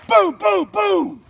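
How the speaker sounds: loud, high mouth-made gun booms; imitated gunshots. Dad fakes three pistol shots to scare the boys. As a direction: pop off three shots with your voice. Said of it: "And I just went".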